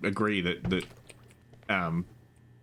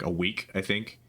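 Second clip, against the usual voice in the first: ordinary talk, nothing marked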